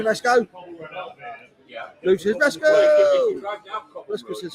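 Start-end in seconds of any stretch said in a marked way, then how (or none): 2.4-3.4 high sing-song call. Retold or imitated. Voicing a chat greeting.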